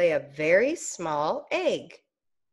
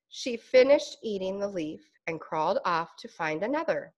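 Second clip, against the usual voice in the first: usual speaking voice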